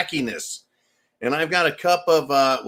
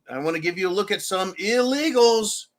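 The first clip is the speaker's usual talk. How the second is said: ordinary delivery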